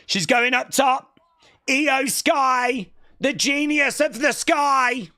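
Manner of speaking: wrestling commentator. Acted. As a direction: call it like ringside commentary. Calling the match.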